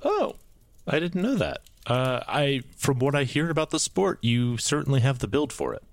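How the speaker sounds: surprised. Acted. Tez surprised, higher voice.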